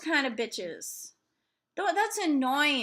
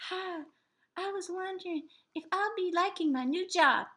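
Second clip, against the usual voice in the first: higher, softer mock caller voice; timid caller; 4 semitones higher, 4 dB quieter than usual. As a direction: play the caller, a little meek. Mocking a caller to psychic.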